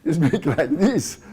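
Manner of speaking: loud, laughing festive tone; wedding voice. Shown as a demonstration. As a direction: burst out merrily, as at a wedding. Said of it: "If you go to a marriage".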